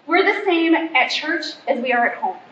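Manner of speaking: ordinary talk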